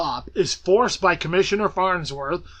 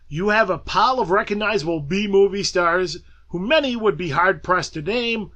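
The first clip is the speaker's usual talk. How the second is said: ordinary delivery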